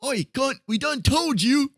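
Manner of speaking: a clumsy Australian accent attempt, barked out; bad Australian accent. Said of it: "I don't know how to do an Australian accent".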